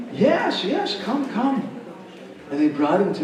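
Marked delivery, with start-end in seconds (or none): 0.1-1.7 high, inviting call; delighted beckoning, pitched way up; eager welcome. Acted. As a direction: usher them in with a high-pitched voice.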